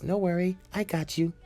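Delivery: lightly voiced girl, pitched high; reassuring girl. Girl catching a stumbling boy, lightly acted high reassurance.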